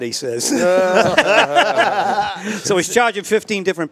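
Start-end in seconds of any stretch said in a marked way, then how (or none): 0.5-2.6 high, loud burst of laughter. Real, not acted. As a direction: laugh hard at your own joke. Laughs at own punchline.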